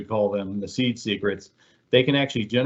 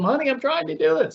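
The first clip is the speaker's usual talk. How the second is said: very high, strained cry to her husband; shrill overwhelmed wife. Retold or imitated. Fake wife calls out to husband, very high and strained. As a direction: imitate a wife at her wits' end. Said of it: "acting like she is this ... beaten housewife".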